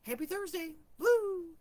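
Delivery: high, loud whoop of a cheery greeting; excited cheer. Retold or imitated. Answering a gloomy coworker's email, with a loud happy whoop. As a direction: cheer it out like a party. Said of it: "and like".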